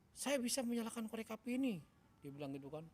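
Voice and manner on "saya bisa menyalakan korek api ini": imitating a child's higher, louder declaration; acted confident affirmation, raised pitch. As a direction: belt it out like a child convincing himself. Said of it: "kencang, meyakinkan, dan penuh percaya diri".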